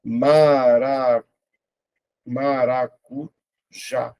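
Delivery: MARACUJÁ very slow, split into ma-ra-cu-já; syllable by syllable. Shown as a demonstration. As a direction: break MARACUJÁ into drawn-out syllables with gaps. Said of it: "vou separar".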